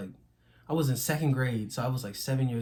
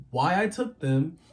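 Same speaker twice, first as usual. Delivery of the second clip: ordinary talk, nothing marked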